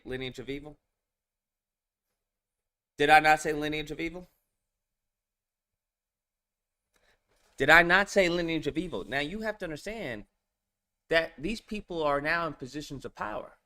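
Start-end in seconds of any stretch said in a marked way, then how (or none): 3.0-9.1 louder and faster, hammering the point home; emphatic louder repeat. Shown as a demonstration. Co-host repeating his earlier warning, loud and insistent. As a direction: drive it in, each time more forceful.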